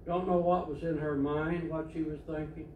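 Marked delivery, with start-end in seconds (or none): none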